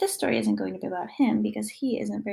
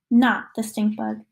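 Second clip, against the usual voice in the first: ordinary delivery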